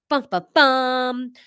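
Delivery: slow suspenseful drumroll sung on bum; vocal drumroll. Acted. Drumroll before announcing the vote result.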